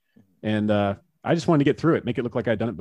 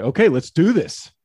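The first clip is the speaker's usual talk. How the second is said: over-exuberant pumped-up reply; loud, high and slow, psyched up; retold gung-ho battle cry; emphatic; 5 semitones higher, 6 dB louder than usual.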